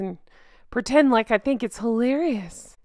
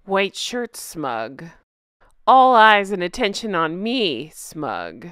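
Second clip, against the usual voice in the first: mock smug; 6 semitones lower than usual. Mocking smug royal photos, low self-satisfied drawl. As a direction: drawl out the smugness, lightly.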